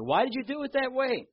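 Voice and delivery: high, aggrieved plea; mock a hurt believer's whine; voicing a resentful prayer to God; complaining to God. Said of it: "start to talk to God in that particular way".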